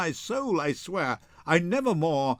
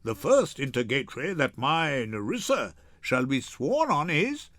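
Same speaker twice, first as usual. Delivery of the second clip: usual speaking voice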